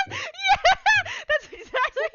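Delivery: high, loud laughing agreement; laughing hard. Real, not acted. Game master agrees with a player, cracking up. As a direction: burst out laughing through the words.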